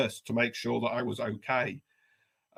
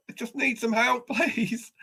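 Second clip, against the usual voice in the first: higher, half-laughing request for help; sheepish plea; 10 semitones higher than usual. Retold or imitated. Stuck man asking the bloke for help, higher and sheepish. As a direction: ask for help a bit embarrassed.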